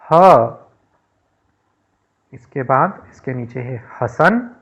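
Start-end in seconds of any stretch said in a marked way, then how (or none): 0.0-0.6 letter ह called out loud and high. Shown as a demonstration. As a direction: shout the sound ह for the class. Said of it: "इसको हम जोर से बोलेंगे". (अक्षर ह ज़ोर से बोलें)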